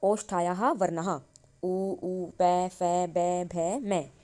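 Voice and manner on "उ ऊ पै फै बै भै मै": each labial letter sounded out in turn; sounding out the labial letters for the class; lip sounds recited. Shown as a demonstration. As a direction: drill पै, फै, बै, भै, मै one at a time. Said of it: "इनका उच्चारण हम होठ से करते हैं".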